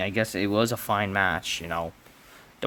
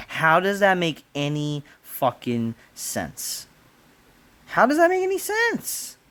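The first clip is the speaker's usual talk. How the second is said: how does that make sense, incredulous; outraged disbelief; exasperated rant; 5 semitones higher than usual. Real, not acted. Ranting at a wrestling result.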